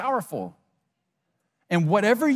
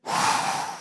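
demonstrative big breath; 3 dB quieter than usual. Shown as a demonstration. Speaker stopping everyone to breathe, exaggerated breath. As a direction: make a production of breathing out. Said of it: "I've gotta breathe".

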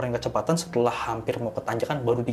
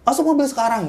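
very high, loud shouted order; frantic shout; 14 semitones higher, 7 dB louder than usual. Retold or imitated. Woman screaming at her friends to get into the car, frantic shout. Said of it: "gitu kan".

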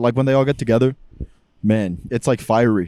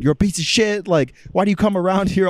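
mock angry rant, pitched up; retold flaming; 6 semitones higher than usual. German lady going in on a family friend's husband, mock angry. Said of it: "Flaming this dude".

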